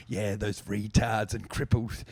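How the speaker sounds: low, slow, sneering fan; lightly voiced cruel heckler; mean bully voice.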